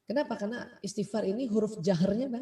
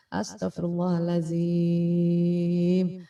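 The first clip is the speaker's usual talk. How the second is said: very slow, drawn-out astagfirullahaladzim; slow istighfar demo; 4 semitones lower, 4 dB louder, much slower than usual. Shown as a demonstration. Teacher demonstrating breathing out through the dhikr, slow and drawn out. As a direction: stretch every syllable as one long breath out. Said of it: "itu perhatikan nih".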